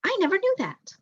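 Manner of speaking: put-on high, amazed young voice; a boy stunned by news of his father; incredulous. A narrator's reading. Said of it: "said Martin incredulously".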